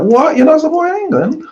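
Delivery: high, incredulous squeak of surprise; mock the colleague's high, shocked disbelief. Imitating a colleague stunned he won't back England. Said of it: "she's like".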